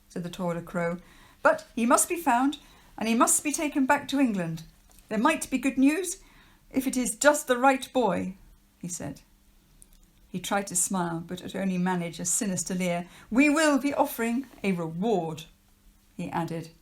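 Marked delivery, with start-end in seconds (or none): 1.4-8.4 higher, louder, fast stern official warning. Acted. Trapwood insists. 13.3-15.4 higher, louder, fast stern official warning. Acted. Trapwood adds.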